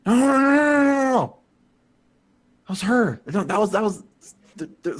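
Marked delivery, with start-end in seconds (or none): 0.1-1.4 higher, louder repeated no; loud protest. Real, not acted. Player insisting over Ace, loud and high. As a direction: shout them down.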